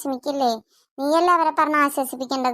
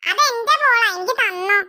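put-on indignant child; 6 semitones higher than usual. Children quarrel over a ball.

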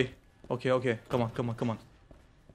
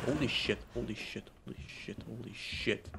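hushed, softer stunned swearing; awed hush; 2 semitones lower, 13 dB quieter than usual. Real, not acted. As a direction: breathe it out in quiet awe. Stunned, sneaking past a monster.

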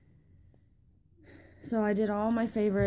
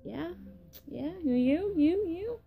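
rising playful you, you; teasing; 7 semitones higher, 3 dB quieter, slower than usual. Real, not acted. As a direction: point at each viewer, teasing. Teasing pointing at viewers.

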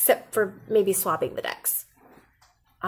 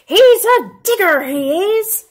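loud, much higher shout to the ship; yelling to the crew; 10 semitones higher, 9 dB louder than usual. A narrator's reading. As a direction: bellow it back to the boat. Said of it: "then yelled back to his crew".

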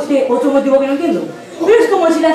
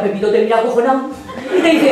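ordinary delivery

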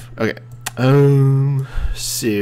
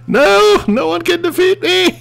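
high, loud gloating squeal; mock triumphant boast; 6 dB louder than usual. Player boasts for his unstoppable machine, mock triumphant. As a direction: crow like an unbeatable champion.

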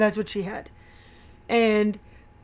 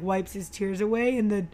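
ordinary talk, nothing marked

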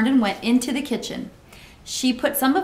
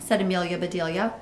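plain reading voice

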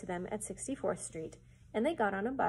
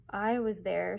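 plain reading voice

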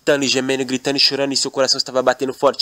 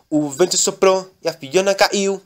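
ordinary delivery

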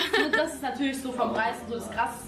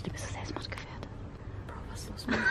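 whisper; 11 dB quieter than usual. Real, not acted. Reacting to a friend in a whisper.

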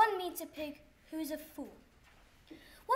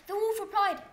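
usual speaking voice

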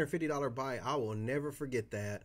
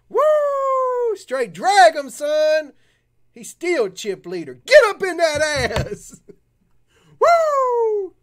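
much higher and much louder, whooping; real celebration yells at the card table; real hype shouting; 17 dB louder, much slower than usual. Real, not acted. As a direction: yell like your team just won. Hyped over a card break.

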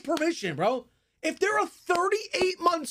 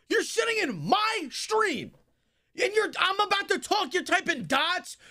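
screaming furious rant; yelling at the chat in rage; berserk rage; 5 semitones higher than usual. Real, not acted. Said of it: "as I'm screaming".

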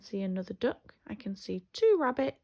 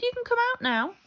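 high, louder coaxing call; 8 dB louder, faster than usual. A narrator's reading. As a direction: call the hiders out. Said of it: "he called".

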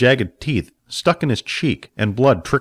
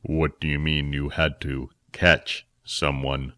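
much lower, softer and slow; a stern elder questioning the story; Phil, sceptical; 8 semitones lower, 7 dB quieter, slower than usual. Acted.

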